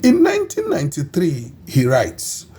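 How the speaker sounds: ordinary delivery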